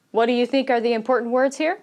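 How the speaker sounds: ordinary delivery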